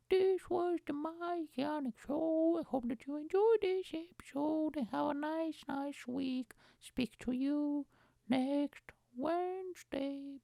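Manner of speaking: high, slow sing-song sign-off; slow high show outro; outro announcer. Acted. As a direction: croon the goodbye to listeners.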